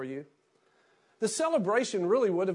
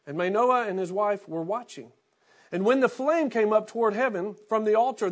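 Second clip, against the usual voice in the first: steady, formal reading of the passage, a little higher and fuller than his talk; scripture reading; 4 semitones higher, 4 dB louder than usual. Acted. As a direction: read the passage aloud to the congregation. Pastor reads the Bible passage.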